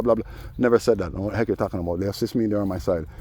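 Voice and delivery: ordinary delivery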